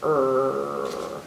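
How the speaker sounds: "er" low and held like a growl. Shown as a demonstration. Reader showing children what R says, growl-like "er". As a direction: growl out a long "er". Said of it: "kinda like a growl".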